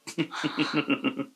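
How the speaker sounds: short real laugh at own joke. Real, not acted. Laughs at own suggestion about invisible clothes. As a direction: let a quick laugh slip out.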